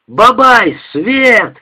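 high, loud howl; howled order. Acted. Soldier yells to Babai to turn on the light. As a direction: yell across the barracks. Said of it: "взвыл Женька на всю роту".

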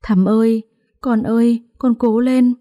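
high, rising wail for her daughter; mother wailing as her sick daughter is carried in. A narrator's reading. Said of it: "tiếng gào khóc của bà cả".

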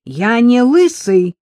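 loud, slow, indignant protest. A narrator's reading. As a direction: push back hard against the insult. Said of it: "возмутился Серафим".

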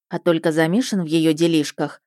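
plain reading voice

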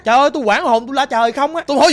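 usual speaking voice

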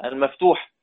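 normal talking voice

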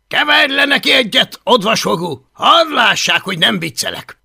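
put-on loud, high, swaggering boy leader; bossy bully leader. Boastful Viking boy bossing the others, loud and high. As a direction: bark orders like the self-made chief.

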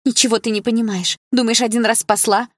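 high voice, rising agitation; agitated. A narrator's reading. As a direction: protest in mounting distress. Upset victim protests being helped.

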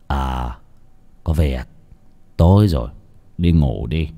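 low, subdued grandfather; weary, downcast. A narrator's reading. Grandfather sends grandson to bed, downcast and listless. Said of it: "trông ông buồn buồn".

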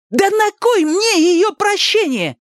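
very high, drawn-out outburst; indignant retort. A narrator's reading. Ali rejecting Milos's congratulations, indignant. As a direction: throw it back indignantly.

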